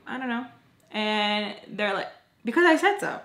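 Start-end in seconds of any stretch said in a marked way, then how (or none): 2.5-3.2 high, loud, snippy "because I said so"; mock bossy host. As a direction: play the know-it-all boss shutting her down. Bossy party host mocked.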